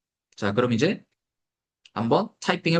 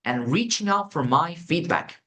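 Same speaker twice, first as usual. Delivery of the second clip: slow dictation; much slower than usual. Shown as a demonstration. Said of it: "dictation example".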